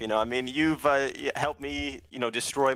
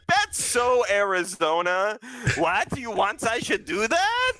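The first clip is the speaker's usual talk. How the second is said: Bobby's mock accent; 8 semitones higher, 3 dB louder, slower than usual. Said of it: "he's like".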